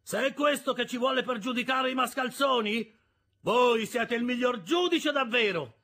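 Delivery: high, heated insult; angry taunt. A narrator's reading. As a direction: taunt the scolding woman back. Worker keeps insulting the sister.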